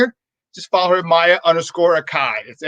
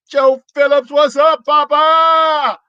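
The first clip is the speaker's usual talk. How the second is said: much higher and louder greeting; hyped welcome; 10 semitones higher, 5 dB louder, much slower than usual. Real, not acted. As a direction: hype the guest's entrance.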